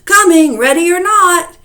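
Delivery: high, very loud singsong call; hide-and-seek yell. Acted. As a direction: holler it down the road. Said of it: "yelling all the while".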